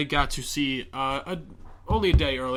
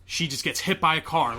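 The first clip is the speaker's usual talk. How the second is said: ordinary delivery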